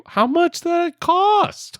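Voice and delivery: high, drawn-out incredulous squeal; slow, squeaky disbelief; mock astonishment. Acted astonishment at scratcher quantity.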